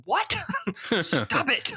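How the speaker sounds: high-pitched, play-acted bewilderment; hammed-up incredulous reaction; mock disbelief. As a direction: act out being baffled, half laughing. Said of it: "I would probably do the".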